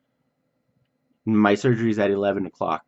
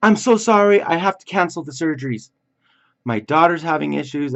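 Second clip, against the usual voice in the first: much higher, louder, strained hurried doctor apologizing; a hammed-up flustered surgeon calling out to the room; surgeon's apologetic voice; 9 semitones higher, 6 dB louder than usual. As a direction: play the rushed surgeon breaking bad news, pitched way up. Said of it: "and he's like".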